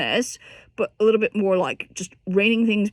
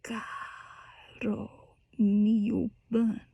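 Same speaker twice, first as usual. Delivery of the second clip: very soft, whispered; pianissimo whisper; 7 dB quieter, much slower than usual. Shown as a demonstration. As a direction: breathe the words out as pianissimo. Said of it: "when you go into that pianissimo".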